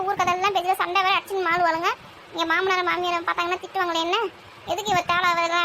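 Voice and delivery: ordinary talk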